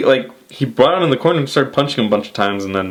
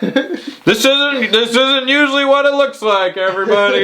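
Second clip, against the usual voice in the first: higher, hammed-up nervous boss; smooth it over for the crowd; mock Vince excuse; Vince impression; 13 semitones higher than usual. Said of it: "Vince is trying to cover that up".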